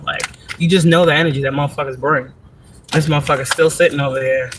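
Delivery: ordinary talk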